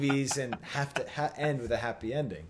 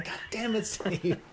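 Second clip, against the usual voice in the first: ordinary delivery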